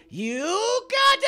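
high, very slow stretched cry; challenge. Real, not acted. Gamer charging SpongeBob, high slow stretched cry.

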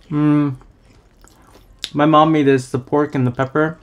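talking between chews; muffled, food in mouth; mouth full, chewing. Real, not acted. Seen 0: Bites food at -3; cheeks stay full while speaking, he lifts stir-fry.

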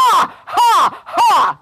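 big, booming separate "ha"s; very loud lion laugh. Acted. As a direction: roar with exaggerated laughter. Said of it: "his laugh was so loud".

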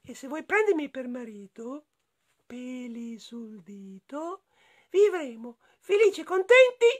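high-pitched rhyming character voice, chanted refrains; freed enchanted prisoner, jubilant. Acted. The freed prisoner proposing marriage to Lucilla, high chanting voice.

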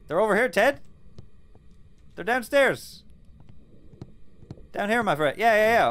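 high, loud call across rooms; calling from far away. Real, not acted. Calling ghost to them.

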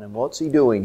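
normal talking voice

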